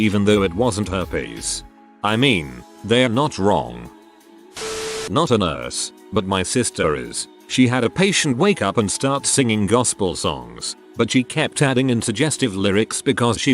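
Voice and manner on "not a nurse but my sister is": ordinary delivery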